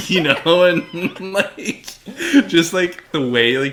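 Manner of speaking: high, loud, helpless laughter through the words; laughing. Real, not acted. Fan cracking up over the villain's reveal, laughing. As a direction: crack up while trying to keep talking.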